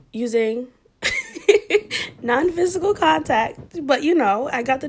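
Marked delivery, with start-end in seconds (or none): 1.0-2.2 sudden squeaky laugh breaking into the sentence; high burst of laughter. Real, not acted. Laughing at her own sly wording, high burst of laughter.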